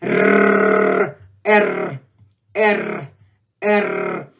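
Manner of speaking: loud "R", first as one long tongue-tip trill; rolled Russian R. Shown as a demonstration. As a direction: roll "R" hard and long, then name it. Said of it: "let's practice it one more time".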